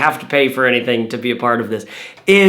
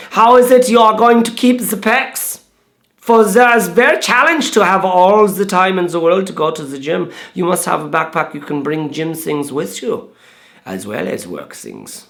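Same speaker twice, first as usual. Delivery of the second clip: put-on German accent; 3 semitones higher than usual. Vlogger imitating his German friend David, mock German accent. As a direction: play a cartoonish German bag designer. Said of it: "My German is like".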